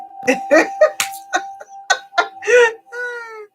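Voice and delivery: squealing high giggle. Real, not acted. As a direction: burst into a high, crying laugh. Laughing at own mic test.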